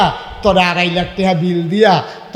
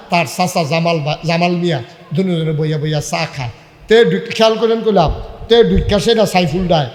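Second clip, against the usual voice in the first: ordinary talk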